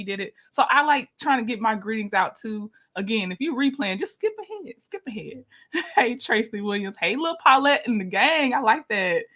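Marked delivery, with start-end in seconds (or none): 4.0-4.7 a silly high chirp telling them to skip; playful squeaky aside, much higher and softer; squeaky high skip ahead. Acted. Telling replay viewers to skip. 4.8-5.4 a hushed repeat to herself; soft murmured repeat of the advice; quiet echo. Acted.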